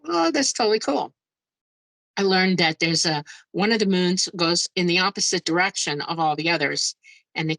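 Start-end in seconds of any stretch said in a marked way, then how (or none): 0.0-1.1 thrilled. Retold or imitated. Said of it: "I was like".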